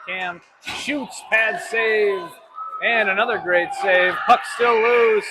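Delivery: loud, high shouted save call; excited shouting. Real, not acted. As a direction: yell the saves as they happen. Big saves in the play-by-play.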